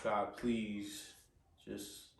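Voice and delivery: whispered plea to God, very soft; retold desperate prayer, barely voiced; hushed prayer. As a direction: pray under your breath, pleading. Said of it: "I'm praying".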